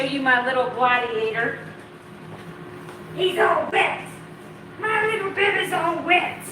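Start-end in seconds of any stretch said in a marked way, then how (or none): 3.2-6.4 high pet-talk voice, half whispered. Real, not acted. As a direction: coo at the wet little dog. Cooing to her wet puppy.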